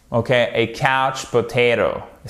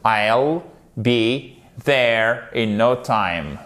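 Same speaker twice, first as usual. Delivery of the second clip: slow demo; 3 semitones lower, much slower than usual. Shown as a demonstration. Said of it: "le apostrophe deux L, c'est la contraction".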